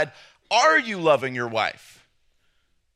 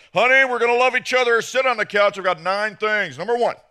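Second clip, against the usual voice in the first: loud, higher, brisk announcement; over-eager husband; 5 semitones higher, 5 dB louder than usual. Acted. Husband home tonight announcing love to wife, loud brisk dutiful voice. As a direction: rattle it off like a checklist.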